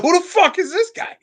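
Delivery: high-pitched retold indignation; incredulous, high mock outrage; pissed off. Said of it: "Oh, I was so pissed".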